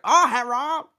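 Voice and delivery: high put-on caricature voice; mock a movie caricature, high. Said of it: "He's like".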